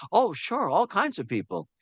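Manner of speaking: high-pitched, swooping breezy answer; exaggerated high-voiced assurance. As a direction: pitch it up high as a retold reply. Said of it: "I went way up high".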